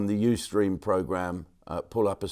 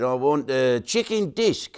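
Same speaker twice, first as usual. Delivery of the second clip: mock New Zealand accent; 4 semitones higher than usual. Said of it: "the New Zealand guy who goes".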